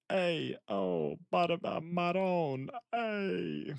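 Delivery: high, drawn-out mock Italian exclamations; hammed-up Jersey Italian, vowels stretched out; Italian-American accent. Streamer showing off Italian slang to viewers, mock Italian accent. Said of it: "it's the Italian".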